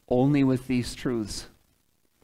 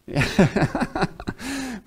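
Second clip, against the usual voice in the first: high-pitched burst of laughter; 8 semitones higher than usual. Real, not acted. Preacher posing as a branch for congregation, laughing. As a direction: laugh at your own silly pose.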